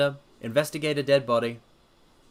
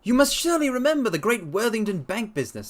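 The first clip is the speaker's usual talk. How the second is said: a higher, assured detective; lightly voiced confident sleuth; Holmes voice; 9 semitones higher, 3 dB louder than usual. Holmes promising to clear up the case, assured. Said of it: "said Holmes".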